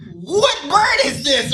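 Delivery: high, loud and drawn out; incredulous mock outcry. Storyteller reliving the bird in the bush, incredulous outcry. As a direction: stretch it into a comic cry of disbelief. Retold alarm at giant bird.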